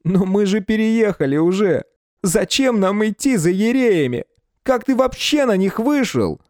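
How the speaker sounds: high, panicky and hysterical; rising panic, near hysterics. A narrator's reading. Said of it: "истерические нотки".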